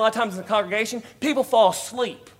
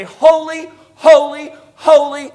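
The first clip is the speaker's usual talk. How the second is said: each holy called out loud and high; angels crying holy; 5 semitones higher, 8 dB louder, slower than usual. Retold or imitated. Preacher voicing the angels' cry of holy, loud and high. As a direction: ring it out like angels calling across heaven. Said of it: "The angels on one side say".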